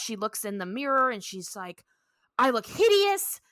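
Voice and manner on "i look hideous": high, loud mock horror; hammed-up distress, pitched way up; mock dismay. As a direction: act out horrified dismay. Luann sees herself before test.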